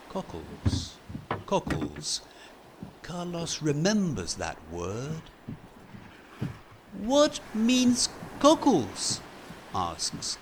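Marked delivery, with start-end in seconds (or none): 0.1-2.2 soft whispered repetition; whispered to himself. Acted. Carlos puzzling over a word, whispered to himself. 6.9-9.2 much higher, slow puzzled question; puzzled learner. Acted. Carlos asking Fred about cockles, high slow puzzled question.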